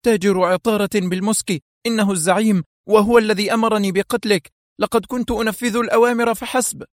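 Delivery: captured thug confessing in a high, frightened voice; trembling with terror. A narrator's reading. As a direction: spill it all out, scared for his life. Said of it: "وهو يرتجف رعبا".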